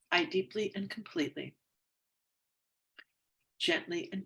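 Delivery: tapping affirmation. Acted. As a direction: read it like a calm self-help script, easing off at the end.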